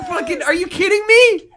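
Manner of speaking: high, stunned are-you-kidding-me; incredulous outburst. Acted. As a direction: throw up your hands in disbelief. Mock outrage at teammate.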